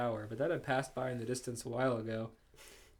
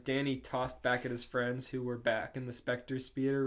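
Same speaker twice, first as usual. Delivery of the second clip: ordinary delivery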